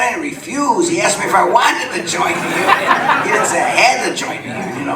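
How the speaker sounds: half-laughing disbelief at the accusation; laughing protest. Retold or imitated. Telling the interviewer how he argued back to Chuck, laughing.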